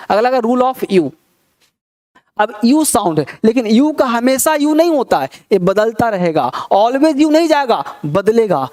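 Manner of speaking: ordinary talk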